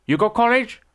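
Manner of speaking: high, loud questioning in a mock Chinese accent. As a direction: quiz him like the shop lady, high-pitched. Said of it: "one day she just said".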